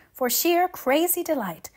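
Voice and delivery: rapturous. A narrator's reading. Anne rhapsodizing about spring.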